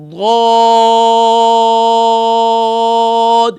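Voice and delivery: ضاد stretched five counts long with an open alif, loud; five-finger madd. Shown as a demonstration. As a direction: pull ضاد out long and full, no waw. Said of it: "پانچ الف کی مقدار".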